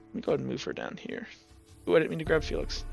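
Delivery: ordinary talk, nothing marked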